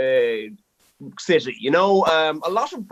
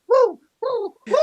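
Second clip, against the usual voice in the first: pigeon coo imitation; 4 dB louder than usual. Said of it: "they just stand in front of you like".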